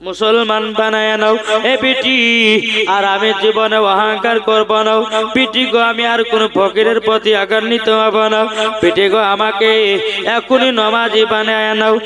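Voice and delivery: melodic preacher's rendering of a weeping father; imitated tearful plea. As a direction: chant the father's repentance in quick, pleading runs. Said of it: "তার আব্বা পিঠির কাছে কেঁদে বলছে". (কান্নার সুরে বাবার অনুনয়ের অভিনয়)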